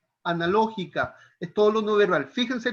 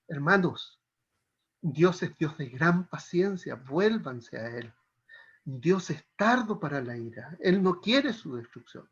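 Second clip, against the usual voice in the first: patient, gentle appeal; 4 semitones lower than usual. Shown as a demonstration. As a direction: gently invite them back, unhurried. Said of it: "debería corresponderse a decir". (llamado sereno y paciente)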